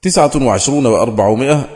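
normal talking voice

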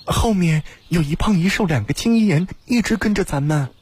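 音调拔高很多的角色嗓音，急着提醒同伴; high-pitched call to warn companions; 呼喊报信. A narrator's reading. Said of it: "王宇仙呼道". (高声呼叫，提醒同伴)

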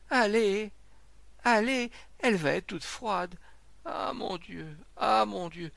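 high, wailing plea; distraught lament. A narrator's reading. Distraught man to the crowd about his wife, wailing plea. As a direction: urge them on in anguish.

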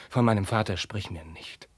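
ordinary delivery

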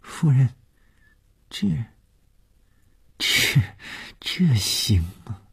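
hesitant, high-pitched halting question; stammering. Acted. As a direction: falter between words, unsure whether it's allowed. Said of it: "她结结巴巴地说". (结结巴巴，犹豫地问)

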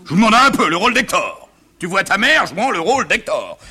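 high-pitched exasperated father; indignant scoffing. Acted. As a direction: exclaim at the absurdity.

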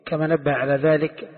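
ordinary talk, nothing marked

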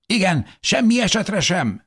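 very high, insistent agreement; emphatic agreement. A narrator's reading. Third person agrees to bar the baby, emphatically. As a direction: back the refusal in a high voice. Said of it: "helyeselt egy harmadik".

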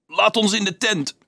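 high-pitched plea; begging. A narrator's reading. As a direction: beg them weakly. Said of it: "smeekte hij".

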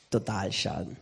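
low, soft, guilty mumble; sheepish husband. Retold or imitated. Husband admitting the wreck to his wife, low and sheepish. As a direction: confess it small and ashamed.

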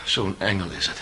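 low, soft sarcasm; sarcastic. Acted. Accused mocking the witness as an angel, quiet. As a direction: sneer it under your breath. Sarcastic aside about Katja.

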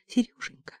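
urgent frightened whisper. Acted. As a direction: whisper fast, as if someone might hear. Frightened call for help.

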